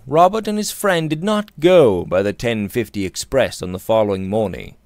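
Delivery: usual speaking voice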